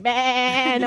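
imitated long baa of a sheep; sheep's bleat. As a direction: bleat it out like a rushing flock. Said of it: "the sheep just came running".

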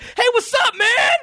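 very high-pitched mock excited hail; high, hammed-up greeting; over-eager fan greeting. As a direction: imitate an overexcited drive-thru guy calling out. Imitates drive-thru guy's eager greeting.

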